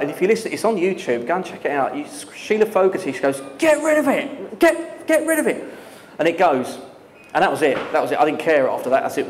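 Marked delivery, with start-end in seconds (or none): 3.6-5.6 high, panicky mock yell; panicked scream. Co-presenter panics at the live swearing, high mock scream. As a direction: shriek the order in panic, much higher. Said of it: "Sheila Fogarty screams out".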